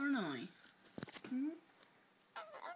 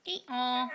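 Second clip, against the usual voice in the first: raised, louder donkey imitation; donkey bray; 5 semitones higher, 7 dB louder than usual. Shown as a demonstration. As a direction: prompt with a donkey's hee-haw. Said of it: "Where's donkey?".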